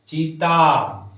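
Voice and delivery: चीता with a slow, loud, drawn-out long ii; long ii stressed. Shown as a demonstration. Teacher stresses the long vowel for the class, चीता drawn out. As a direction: lean on चीता, stretching the ee. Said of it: "उच्चारण में जोर देना".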